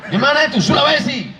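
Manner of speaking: high, brusque phone manner; firm. Shown as a demonstration. Showing mothers how to talk on the phone, firm. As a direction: fire it out firmly, no softness. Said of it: "harus tegas".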